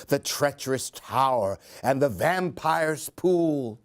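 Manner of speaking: plain reading voice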